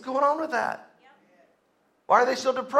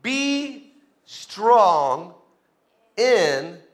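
"Be strong" stretched out, each word held; deliberate, weighty pauses after "strong" and "in"; emphatic slow delivery; much slower than usual. Real, not acted. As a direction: draw out "Be" and "strong", pausing before "in". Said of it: "Let me say that again".

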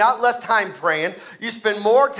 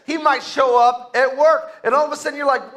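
usual speaking voice

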